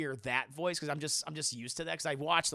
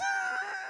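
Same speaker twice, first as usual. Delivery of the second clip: high shrieking laugh. Real, not acted. Laughing at own joke.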